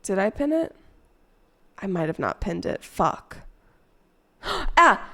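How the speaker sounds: ordinary talk, nothing marked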